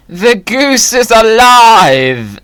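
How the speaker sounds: slow, loud mad-scientist cry; triumphant shout. Acted. Goose finally works.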